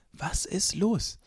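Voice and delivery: ordinary talk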